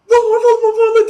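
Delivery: high panicked screams; screaming his name. Retold or imitated. Teen running down the dark hallway, screaming. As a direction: shriek the name while running.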